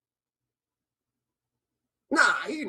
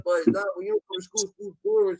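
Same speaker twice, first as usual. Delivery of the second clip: mock whiny kid; 13 semitones higher, faster than usual. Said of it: "He said".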